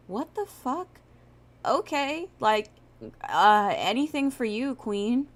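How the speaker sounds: high, incredulous mock boyfriend voice; baffled boyfriend. Streamer retells boyfriend agreeing to girlfriend's odd request, baffled and high.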